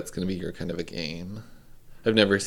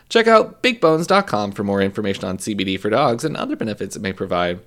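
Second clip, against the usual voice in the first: ad read; 4 dB louder than usual. Acted. Reads a sponsor ad.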